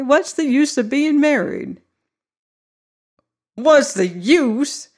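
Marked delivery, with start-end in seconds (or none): 3.6-4.9 put-on slow, higher old man's scornful echo; an old man sneering the question back, drawn out. A narrator's reading. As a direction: toss the words back with scorn. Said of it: "echoed the bridegroom with scorn".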